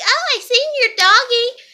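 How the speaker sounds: mocking coworker. Mocking the coworker who saw the lost dog, high sing-song voice. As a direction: mimic her breezy tone. Mocks coworker's casual remark.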